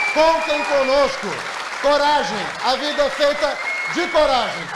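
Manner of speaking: building to a loud, high rally cry; rally speech. Real, not acted. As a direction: rouse the crowd, rising to a roar. Closing rally cry to supporters.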